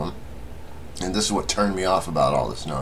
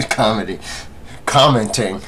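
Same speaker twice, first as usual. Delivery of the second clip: chuckling between words, pitched up; amused laughing; 5 semitones higher, 3 dB louder than usual. Real, not acted. Vlogger tripping over the word for video comments, laughing. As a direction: laugh through a self-correction. Laughs at own slip of the tongue.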